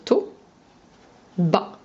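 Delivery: "to, ba" in reversed order, long pause between; syllabes inversées séparées. Shown as a demonstration. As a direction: dictate "to, ba" as two isolated syllables. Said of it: "les syllabes en inversé". (« to, ba » inversé et détaché par une pause)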